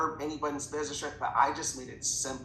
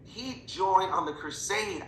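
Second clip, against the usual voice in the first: ordinary talk, nothing marked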